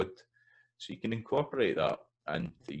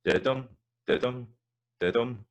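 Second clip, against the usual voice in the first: didum chanted higher and louder, short-long; didum rhythm; 7 semitones higher, 4 dB louder than usual. Shown as a demonstration. Teacher chanting the rhythm after the word list. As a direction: beat out didum clearly. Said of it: "So you get that".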